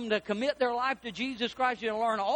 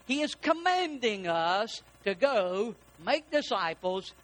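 ordinary talk, nothing marked

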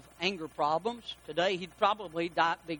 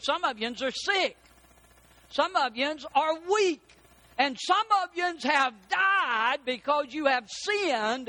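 Paul preaching; 5 semitones higher, slower than usual. Retold or imitated. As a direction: hammer each line like an apostle. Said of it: "and he said".

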